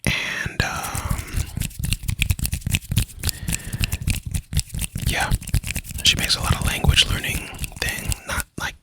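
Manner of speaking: hushed, soft asmr whisper. Acted. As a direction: whisper gently right into the mic. Long soft-spoken ASMR stretch.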